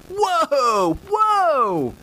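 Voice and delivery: very high, drawn-out mock protest; Bill impression. Imitating a fan whose team is accused of cheating, protesting. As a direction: whoop it up high in exaggerated objection. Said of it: "That was my Bill".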